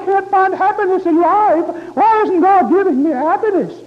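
high, loud whining question; mock the self-pitying churchgoer; whiny complaining believer. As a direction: plead to God in a high, loud mock whine. Preacher voicing a complaining believer.

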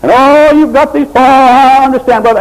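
mock the soothing pastor; hammed-up sweet-talking pastor; calm sweet pastor. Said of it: "nice pastor there with a calm, sweet voice".

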